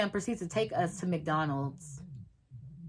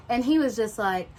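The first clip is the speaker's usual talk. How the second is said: normal talking voice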